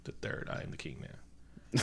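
soft, low, slow unvoiced aside; whispered mock claim to the throne. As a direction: breathe it out like a secret. Whispered follow-up quote.

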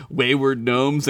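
normal talking voice

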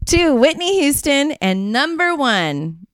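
big awards-show announcer voice; countdown announcer. Pretend. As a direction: call out each name like an MC. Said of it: "You got that voice".

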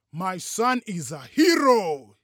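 slow, loud declaration; solemn booming announcement; serious, proud father. A narrator's reading. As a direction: proclaim it slowly and loudly, in all seriousness. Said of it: "in all seriousness".

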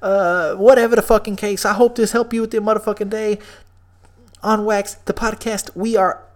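loud, high radio-host shout-out; hype podcast outro. Acted. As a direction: pump up the listeners like a host signing off.

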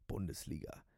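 low, quiet head-shaking disbelief; disdainful murmur. Acted. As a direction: drop it like a dirty word. Cabbie shakes head in disdain.

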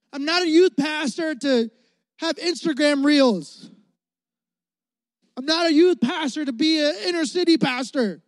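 high, loud preacher's cry about being a youth pastor; full-force preaching. Acted.

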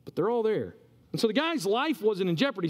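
ordinary talk

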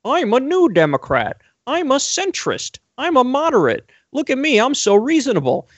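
high, mocking imitation of a smug candidate; mock Hillary centrist. Mocking Hillary's nineties campaign pitch in a high voice. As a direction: parrot her pitch in a high, sing-song mock voice. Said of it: "Hillary Clinton is gonna try to run the old school".

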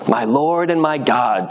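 plain reading voice

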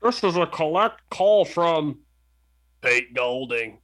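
recorded collect call operator; slow automated operator voice; payphone call. Acted.

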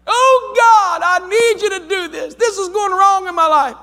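high, loud whining plea to God; whiny complainer. Retold or imitated. Mocking a whiny believer to the church. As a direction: act out a whiny prayer. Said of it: "whiny prayer acted out again".

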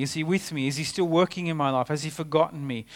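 ordinary talk

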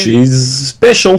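slow, high, drawn-out mockery; mock adoration. Explaining the FBI's verdict on Clinton, mock adoration. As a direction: draw out each word in a sarcastic high voice. Mocking Clinton's special treatment.

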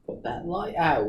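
slow, quiet attempt at a British accent; mock British accent. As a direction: imitate the film line in a British accent. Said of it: "Not even close".

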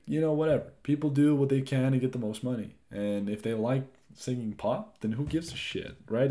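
ordinary talk

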